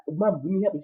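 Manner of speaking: high-pitched imitation plea; retold panic. Son pleading with his mother, mildly panicked. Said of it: "Wyatt is panicking".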